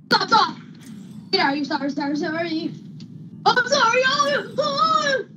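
high panicked yelps; frantic, scared high cries; terrified horror victim. Real, not acted. Panicked yelling during fight.

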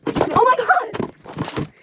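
very high, drawn-out outburst; exasperated squeal. Real, not acted. Streamer struggling while live, high exasperated squeal.

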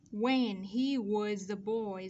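"was a" joined with a z sound, slowly; slow model, z ending of "was" carried into "a"; linked sounds. Shown as a demonstration. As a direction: read "When he was a boy" slowly, linking was into a. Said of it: "đồng thời chúng ta nối âm".

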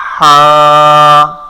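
হা with breathy pharyngeal h, vowel held long; loud, drawn-out, level হা; mid-throat ha. Shown as a demonstration. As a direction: hold হা steady from mid-throat. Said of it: "হা শব্দ উচ্চারণ হবে".

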